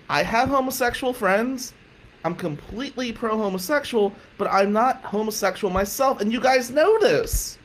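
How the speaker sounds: high, emphatic retold protest; defensive insistence. Son explaining himself to mom and aunt, defensive insistence. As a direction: spell it out, pleading to be believed.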